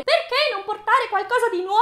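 high, loud mock question; silly put-on voice. Vlogger mocking Disney's question, silly high voice. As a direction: squeal it like an absurd character. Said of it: "Non so perché ho fatto questa voce".

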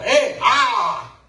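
half-hearted mock grunts from a man with his hands busy; distracted grunt. Husband distracted by hammering, answering with a mock grunt.

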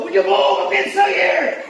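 high, loud panicky pleading; mock panicked yelling. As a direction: overplay the frantic hiker. Mocks panicked hiker yelling.

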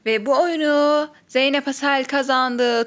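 ordinary talk

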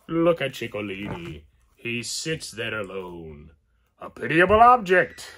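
a lightly voiced Italian Chico accent, rising loud at the end; attempted Chico Marx accent. Reading Chico's line off the figure, in a mild Chico accent. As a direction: try on Chico's mock Italian voice. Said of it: "I can't do Chico".

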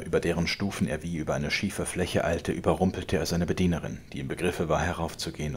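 ordinary delivery

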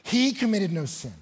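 ringing, emphatic Bible declamation; scripture preached forcefully. Real, not acted. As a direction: proclaim the verse with mounting force.

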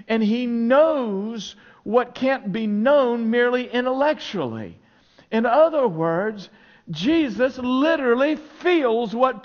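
loud, high, driving sermon delivery; full-force preaching. Acted. Pastor to congregation, preaching at full force.

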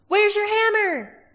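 high, loud, slow battle shout. A narrator's reading. Angela shouting to Roran mid-fight, high and loud. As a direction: yell it over the clash of blades. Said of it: "shouted Angela".

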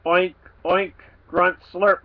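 pig grunts. Acted. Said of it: "my visitor geschnozzled".

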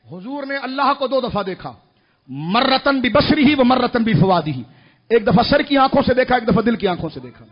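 loud, emphatic scripture and translation; preaching at full force. Real, not acted. Preacher explaining two kinds of seeing, full force. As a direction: proclaim it, then let it settle.

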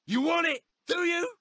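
taunting shout. Acted. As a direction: bark the challenge up high. Taunting wrestler promo lines.

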